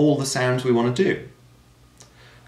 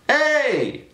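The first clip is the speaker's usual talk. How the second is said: sharp call much higher; calling shout; 3 dB louder than usual. Shown as a demonstration. Teacher demos a healthy shout. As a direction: call out to someone across a room. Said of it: "If you're shouting at someone".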